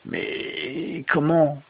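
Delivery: high, very slow, faltering question; stammering. A narrator's reading. Rip baffled by the strange woman, stammering. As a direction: stretch it out in bewildered stammer. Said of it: "bégaya-t-il".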